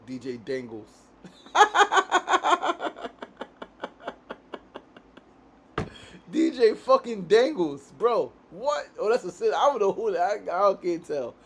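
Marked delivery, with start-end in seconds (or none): 1.2-5.2 long, loud high-pitched cackle; helpless high laughter, trailing away; real laughing fit. Real, not acted. Cracks up at a DJ's name. 6.3-11.3 amused disbelief. Real, not acted. Cracking up over the DJ's name.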